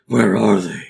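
loud, hammed-up vigilante demand; loud, put-on tough-guy voice; vigilante interrogator impression. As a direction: boom it out like a mock interrogator. Second speaker's take on same line.

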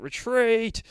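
high, loud cry to retreat; panicked shout. Acted. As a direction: yell it in panic. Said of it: "says the narrator".

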